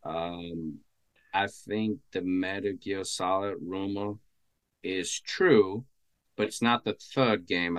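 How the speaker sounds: distant, quiet voice off mic; speaking too far from the microphone; phone mic far away. Real, not acted. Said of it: "sounds like you're a little far from the mic".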